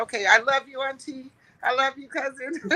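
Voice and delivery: high-pitched loving goodbye; gushing affection. Retold or imitated. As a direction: gush fondly at your relatives. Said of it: "I think I'm just emotional".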